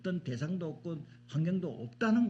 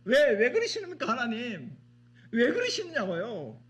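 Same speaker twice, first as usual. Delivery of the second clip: mock complaint to God; 6 semitones higher, 4 dB louder than usual.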